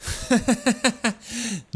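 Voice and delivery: a man's high laugh bursting out; burst of laughter. Acted. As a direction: break into a sudden sneering laugh. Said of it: "Lombard éclata de rire".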